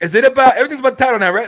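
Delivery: high, loud, flustered rush of words; nervous freeze. Retold or imitated. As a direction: blurt it out like you've frozen up. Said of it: "I fucking froze".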